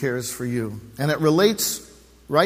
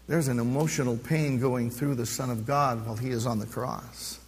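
usual speaking voice

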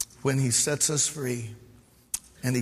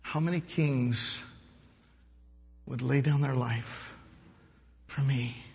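moved, quiet; 6 dB quieter than usual. Real, not acted. Moved pastor quoting song.

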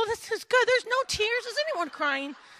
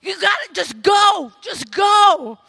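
loud, high, anguished urging; emphatic Naomi; 6 semitones higher, 8 dB louder than usual. Acted. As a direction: push them away with full dramatic force. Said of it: "And then she's like".

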